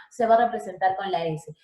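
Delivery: ordinary talk, nothing marked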